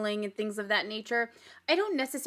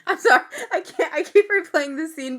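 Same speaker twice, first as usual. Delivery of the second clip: loud, high, helpless laughter in the words; real laugh breaking up an apology; too amused to get it out; cracking up; 5 semitones higher, 7 dB louder than usual. Real, not acted. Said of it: "because it's so funny".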